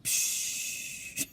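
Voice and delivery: imitated air brake release hiss. Describing the coaster's air brakes, soft hissing noise. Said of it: "it went".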